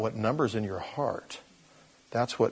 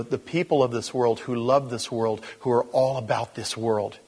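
ordinary delivery

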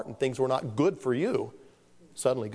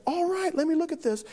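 gentle teacher. Retold or imitated. Teacher checking the boy's sheet.

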